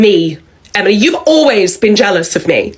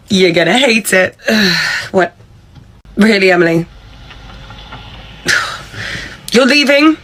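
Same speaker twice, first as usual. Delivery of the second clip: ordinary talk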